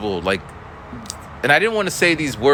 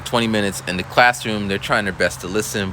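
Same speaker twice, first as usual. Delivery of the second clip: ordinary talk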